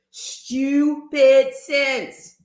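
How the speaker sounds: very slow, each word dragged; drawn out. Real, not acted. Ranting to Marcy, slow scornful drawl on stupid sense. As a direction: stretch "Stupid sense" out slowly.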